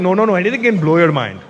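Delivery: lightly voiced Indian fan, correcting; a hint of an Indian accent, matter-of-fact. Indian fan explains mind-blasting to comedian, light Indian accent. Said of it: "every time I did the Indian accent".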